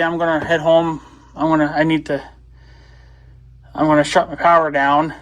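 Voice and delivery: ordinary delivery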